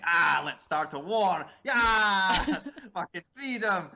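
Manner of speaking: loud, high Glaswegian battle yell, breaking into laughter; hammed-up Glasgow warrior charging; Glasgow war cry. Glaswegians answering the English, mock Scottish war cry. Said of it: "English people went to Glasgow".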